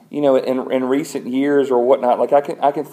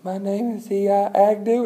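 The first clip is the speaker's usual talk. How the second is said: put-on little boy voice; 9 semitones higher than usual. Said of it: "He goes".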